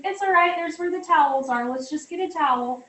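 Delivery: high, easy reassuring voice. Retold or imitated. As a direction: tell the kids it's fine, no fuss. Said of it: "I say".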